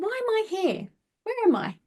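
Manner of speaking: bewildered. Retold or imitated. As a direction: ask it like you've woken somewhere strange.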